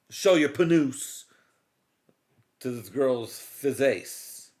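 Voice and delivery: slow, stern questioning; stern cop. Retold or imitated. Cop first asking suspect, slow and stern. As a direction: grill him calmly and slowly. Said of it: "first take of cop line".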